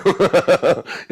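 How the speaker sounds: high, loud chuckle; real laugh. Real, not acted. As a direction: laugh at your own question. Laughs at rhetorical question.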